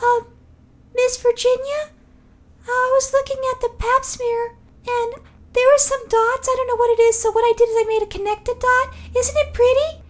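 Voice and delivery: put-on high, sweet childish voice; little kid voice. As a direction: show off proudly like a small child.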